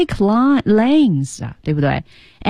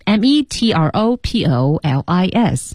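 spelled out. Shown as a demonstration. Host repeating the spelling for learners, letter by letter.

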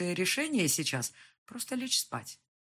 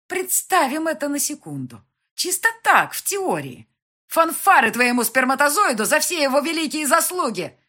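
high, loud, gloating sarcasm; mock him with exaggerated ceremony; snide mockery; 10 semitones higher, 14 dB louder than usual. A narrator's reading. Mother ridiculing his part in fathering, snide mockery. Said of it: "протянула ехидно".

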